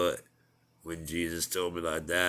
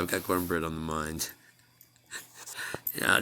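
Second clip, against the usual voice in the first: ordinary talk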